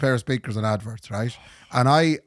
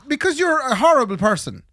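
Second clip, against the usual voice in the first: retold telling-off; 11 semitones higher, 4 dB louder than usual. Telling off the scammer.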